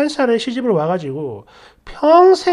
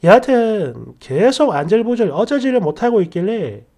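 ordinary talk

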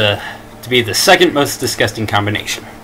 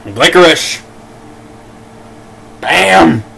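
triumphant; 4 semitones higher, 4 dB louder, much slower than usual. Real, not acted. Triumphant after the last bean.

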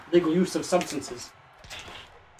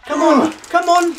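high, slow, loud urging; will him to land the hit; urgent cheering; 10 semitones higher, 6 dB louder than usual. Real, not acted. Urging during final hits.

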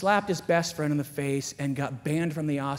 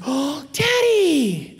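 put-on child's amazed call; 9 semitones higher, 7 dB louder, much slower than usual. Daughter calls out in wonder.

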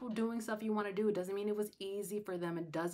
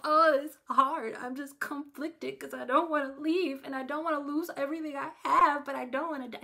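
louder, higher hammed-up whining; mock whiny dithering; 5 semitones higher, 7 dB louder than usual. Imagining grandmother whining instead of fleeing, mock whine. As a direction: play a fussy ditherer for laughs. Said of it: "She didn't go".

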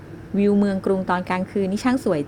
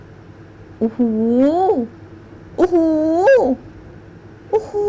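awed wow; 8 semitones higher, 4 dB louder, much slower than usual. Acted. Awed on the Ferris wheel.

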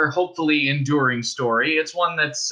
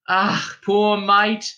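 higher, slow, tender sigh of pity; pitying old woman; 8 semitones higher, 3 dB louder, much slower than usual. Acted. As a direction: feel sorry for the soaked girl. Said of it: "said the woman".

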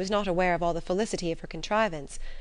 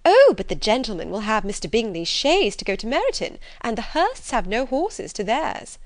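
loud, swooping, animated objection; fussy, insistent mother; triumphant scheming mother; 4 semitones higher, 7 dB louder than usual. A narrator's reading. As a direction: brush the objection aside emphatically. Mother brushes aside the objection.

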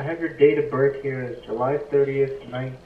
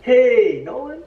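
higher, louder cheery hello; lightened up; 11 semitones higher, 11 dB louder, much slower than usual. Shown as a demonstration. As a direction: lighten it up with a smile. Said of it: "Try it real quick".

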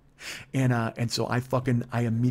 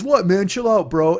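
put-on loud, higher drunk bro voice; drunk retort; 6 semitones higher, 8 dB louder, slower than usual. Drunk passenger brushing off the angry driver, loud.

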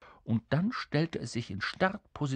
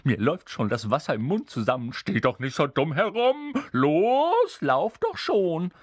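high, loud, greedy imp; impatient calling out; 7 semitones higher, 5 dB louder than usual. Acted. Hungry creature hurrying the king along, calling impatiently. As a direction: urge him on loudly, greedy for a treat. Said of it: "rief es".

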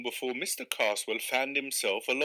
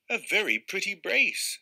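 higher, louder, delighted; pleased; 7 semitones higher, 4 dB louder than usual. Acted. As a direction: savour the catch proudly. Angler admires his two trout.

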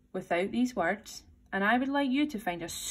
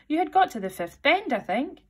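eager; 7 semitones higher, 4 dB louder than usual. A narrator's reading. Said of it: "said Alice very humbly".

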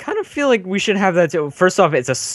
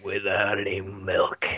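much lower, slow and soft; deadpan tough guy; 8 semitones lower, 10 dB quieter than usual. Acted. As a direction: drop it low and slow like a hard man. Salty Spittoon tough guy skit.

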